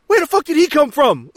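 high, loud actor's outburst; Dick Ritchie audition reading. Retold or imitated. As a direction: belt it out like an overamped auditioning actor. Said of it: "he's miming driving, and he goes".

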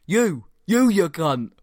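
hammed-up road-rage insult; mock angry outburst. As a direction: imitate an irate driver's abuse. Acting out the driver's angry abuse.

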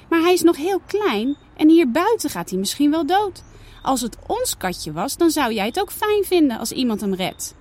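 put-on a child arguing to save the kitten; pleading girl.

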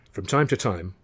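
ordinary delivery, nothing marked

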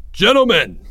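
loud, high call to order; crying out. A narrator's reading. Greg lifts the note for all, crying out. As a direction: hail the whole crowd. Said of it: "Greg cried".

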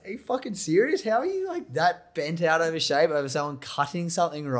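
high-pitched, indignant disbelief; incredulous outburst. Retold or imitated. Viewer reacting to a cake drama on TV, incredulous. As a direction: blurt it out high, as if outraged over nothing.

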